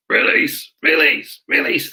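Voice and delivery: hoarse, held-back imitation of shouting; mock shouted command. Handler orders the dog to let go, mock shouting. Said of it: "starts shouting at the dog".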